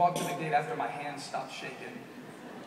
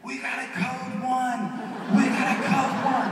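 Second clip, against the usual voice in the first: slow, higher, tinny loudspeaker voice; intercom announcement; 8 semitones higher, 7 dB louder, slower than usual. Retold or imitated. Security guard announcing over the airport intercom, tinny. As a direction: call it out like a PA announcement. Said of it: "I hear this over the intercom".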